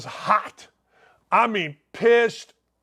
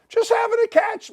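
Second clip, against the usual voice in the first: giddy; 13 semitones higher than usual. Real, not acted. Gushing over playing catch with Counsell.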